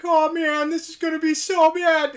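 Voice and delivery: loud, high cartoon Pete voice; Pete impression. Streamer doing Pete from Goof Troop, loud and high. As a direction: ham up a blustery cartoon Pete. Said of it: "Pete from Goof Troop, where he's like".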